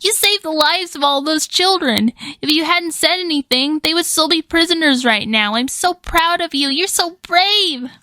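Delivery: kitten-like squeak. A narrator's reading. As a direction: squeak like a tiny adorable kitten. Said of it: "Melissa squeaked, her voice sounding like a tiny, adorable kitten".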